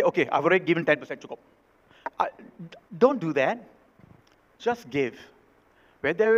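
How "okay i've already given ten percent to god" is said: mock smug giver. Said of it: "you cannot say".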